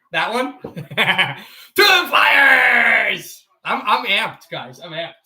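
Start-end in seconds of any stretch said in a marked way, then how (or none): 1.8-3.5 loud, high, long-drawn cheer; hyped shout. Acted. Streamer amped up for the Flyers, drawn-out shout. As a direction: stretch it into a big shout.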